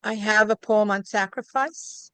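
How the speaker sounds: ordinary talk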